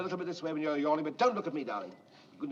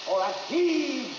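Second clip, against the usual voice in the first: high, loud, drawn-out order; real shout to start the effort; shouted cue; 8 semitones higher, 6 dB louder, much slower than usual. Real, not acted. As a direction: call the heave long and slow. Director cueing effort during take.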